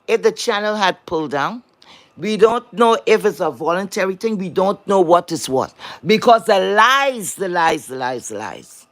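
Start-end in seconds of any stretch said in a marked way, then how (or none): 6.0-8.9 the lies repeated, each fainter and lower until it nearly vanishes; fading repetition. Real, not acted. Scorning liars on the channel, repetition fading to a mutter. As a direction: let the lies trail off into nothing.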